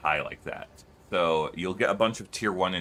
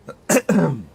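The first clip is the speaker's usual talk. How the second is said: sudden loud throat clear; 11 semitones higher, 10 dB louder than usual. Real, not acted. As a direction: clear the throat hard, right into the mic. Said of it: "Sorry guys, that was loud of me".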